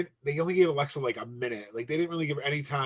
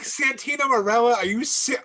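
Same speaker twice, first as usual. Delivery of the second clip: loud, high mock disbelief; exaggerated, high-pitched double take; incredulous outburst; 11 semitones higher, 5 dB louder than usual. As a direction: blurt it out high, cutting yourself off. Acting out disbelief at name.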